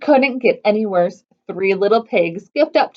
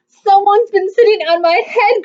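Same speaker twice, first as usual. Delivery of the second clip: put-on higher and louder, gurgling baby bear; a gurgling bear complaint, raised pitch; gurgled; 7 semitones higher, 5 dB louder than usual. A narrator's reading. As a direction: gurgle it out like a distressed cub. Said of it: "gurgled Baby Bear".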